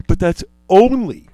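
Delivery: yawning mid-sentence. Real, not acted. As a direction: talk through a yawn. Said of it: "and I'm sorry I'm yawning so much".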